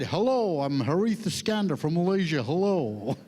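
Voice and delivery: swooping mock American greeting; copied American accent. Malaysian adapting to the American organizer, copied American accent. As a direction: mimic the American's way of saying hello. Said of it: "I talk to you the same way".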